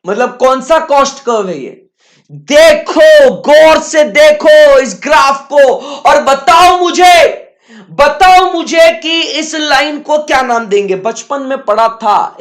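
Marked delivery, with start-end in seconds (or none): 2.3-11.0 loud, slow, high insistence; a teacher shouting for an answer; emphatic demand. Real, not acted. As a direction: drive the question home loudly. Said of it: "teacher demands an answer".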